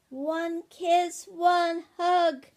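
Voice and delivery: put-on loud, high child's call. A narrator's reading. Ben calls from bed to his parents, calling out loudly. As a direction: sing out a demand from upstairs.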